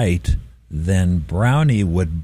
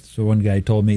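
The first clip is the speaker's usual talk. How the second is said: normal talking voice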